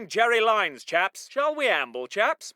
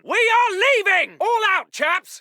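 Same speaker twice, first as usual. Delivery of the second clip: raised officer's call to leave; urgent shouted order; 2 semitones higher than usual. Acted. Officer telling chaps they are leaving, urgent shout. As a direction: call everybody out firmly.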